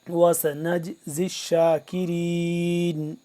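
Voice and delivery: slow, drawn-out tajweed chanting; measured Quranic recitation. Shown as a demonstration. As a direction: recite each word with long held vowels. Teacher recites Quran verse.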